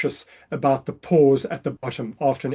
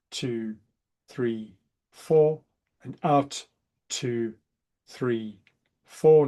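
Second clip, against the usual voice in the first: soothing breath coach counting; guided breathing count; 3 semitones lower, much slower than usual. Shown as a demonstration. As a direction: count them calmly through the breath. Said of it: "I'm gonna count you through".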